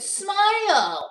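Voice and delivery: high mock catcall smile; guy saying smile. A guy on the street tells her, high mock smile. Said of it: "Anytime a guy said".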